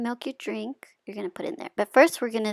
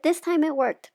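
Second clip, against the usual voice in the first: excited; 8 semitones higher, 6 dB louder than usual. Real, not acted. Hack works on the pot.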